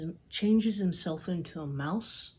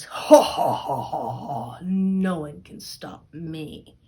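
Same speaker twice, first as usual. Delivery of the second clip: ogre's mocking laugh; 11 dB louder than usual. Ogre laughing off the cat's threat, loud booming mockery. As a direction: cackle like a smug ogre.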